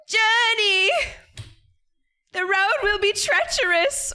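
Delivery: high, loud crone cry; old lady voice. Retold or imitated. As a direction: call out a warning like an old woman. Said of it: "She might say".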